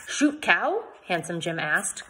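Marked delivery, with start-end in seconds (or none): 0.1-0.8 confused. Acted.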